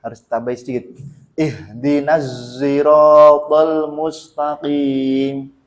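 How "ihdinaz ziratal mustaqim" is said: slow chant with a long buzzing Z in "siratal"; lengthened zay. Shown as a demonstration. Teacher repeats for students, chant with lengthened zay. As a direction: double the Z opening "siratal", make it show. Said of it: "makanya zainnya harus kelihatan".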